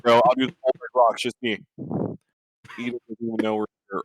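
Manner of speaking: normal talking voice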